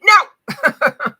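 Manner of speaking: high, loud refusal with a laugh; a laughing but firm boundary. Shown as a demonstration. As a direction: slam the door on it. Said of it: "give your no".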